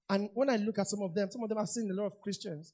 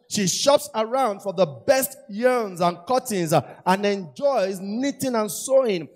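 louder, measured proclamation; proud proclaiming of the verse; emphatic scripture reading; 5 semitones higher, 9 dB louder, slower than usual. Acted. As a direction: read it out to the church, slowly.

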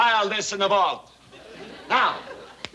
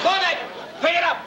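yelling; 11 semitones higher than usual. Acted. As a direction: yell at him to pick it up now. Boss yells at employee.

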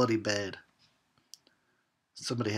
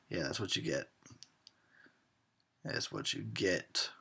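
quiet gloat; 6 semitones lower, 10 dB quieter than usual. Real, not acted. Gloating at victim.

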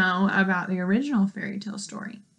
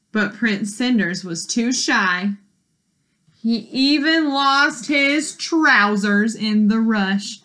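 ordinary delivery, nothing marked

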